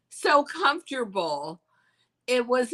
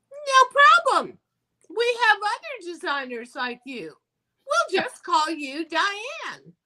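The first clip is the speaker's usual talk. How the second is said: breezy scout; 10 semitones higher, 3 dB louder than usual. Retold or imitated. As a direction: wave it off sweetly like a scout.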